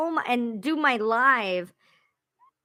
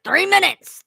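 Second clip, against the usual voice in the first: louder, higher called-out warning; imitated countdown shout; husband counting down; 7 semitones higher, 6 dB louder than usual. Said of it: "he was counting down. He was like".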